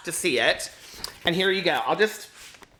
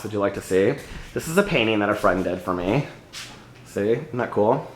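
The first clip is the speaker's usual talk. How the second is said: low tour-guide voice; 9 semitones lower than usual. Acted.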